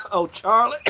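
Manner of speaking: normal talking voice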